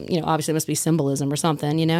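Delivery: ordinary talk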